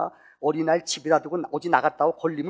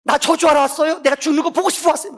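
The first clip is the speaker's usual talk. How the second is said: much higher and louder, accusing; venomous tirade; 11 semitones higher, 12 dB louder than usual. Acted. Mother with AIDS drives the pastor away, venomous shouting. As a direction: attack him with every word, speeding at the end. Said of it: "막 독설을 범는 거예요".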